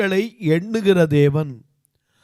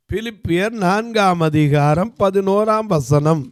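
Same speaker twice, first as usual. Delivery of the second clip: slow, deliberate reading of பிலிப்பியர் நான்காம் அதிகாரம் பதினோராம் வசனம் with weight on பதினோராம்; slow announced verse reference; slower than usual. Shown as a demonstration. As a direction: spell out பதினோராம் வசனம் slowly for the congregation. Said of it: "announcing the verse reference slowly".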